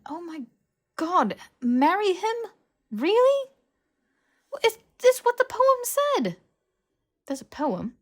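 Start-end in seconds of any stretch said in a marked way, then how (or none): 0.1-6.4 an exasperated friend who cannot believe her ears, rising louder; incredulous outburst. Acted. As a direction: pitch shooting up high and loud in disbelief. Hermione scolds Harry about marrying.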